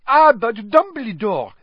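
high, loud French protest; Maxime protesting. Acted.

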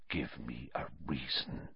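very low, hushed threat; menacing whisper. A narrator's reading. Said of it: "he whispered".

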